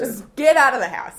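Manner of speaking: mock parental order. Parents packing kid off to camp, mock holler.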